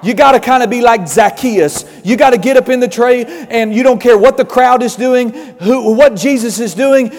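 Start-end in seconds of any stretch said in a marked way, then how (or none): none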